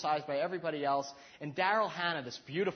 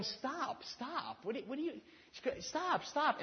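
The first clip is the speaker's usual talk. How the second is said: mock wife's high stop; 9 semitones higher, slower than usual. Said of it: "Colleen going".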